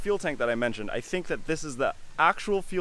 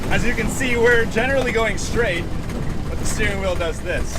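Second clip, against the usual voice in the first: raised voice over bumpy engine noise; on a bumpy road; 7 semitones higher, 5 dB louder than usual. Real, not acted. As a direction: talk loud while jolting along. Driving over bumps, shouting.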